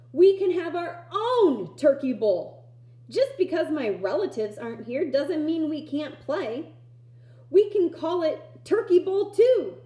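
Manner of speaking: put-on rally the kids with excitement; eager boy rallying his friends; excited. Excited boy proposes game.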